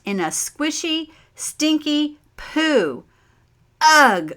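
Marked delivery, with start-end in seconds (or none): 0.3-3.0 higher and slow, drawn out in disgust. A narrator's reading. Narrator to children, reliving stepping in poo, disgusted and slow. 3.8-4.3 loud, higher ugh of disgust; disgusted exclamation. A narrator's reading. Child reacting to stepping in poo, loud disgusted ugh. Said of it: "I exclaimed".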